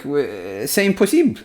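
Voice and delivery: French exclamation. Retold or imitated. Advisor told to shut the Louvre, mock French protest. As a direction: throw up your hands in French disbelief. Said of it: "the lad is like".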